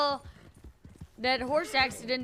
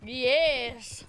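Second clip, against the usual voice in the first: stretched-out mimicked yes; imitating his yes. Teasing a friend with his own yes, imitating. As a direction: drop in the drawn-out copy of his yes.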